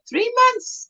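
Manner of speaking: high-pitched mock surprise; questioning reaction. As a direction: echo it back high, in disbelief. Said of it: "I'm like".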